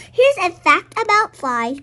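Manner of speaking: normal talking voice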